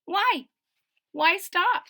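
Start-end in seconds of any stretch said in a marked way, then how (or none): none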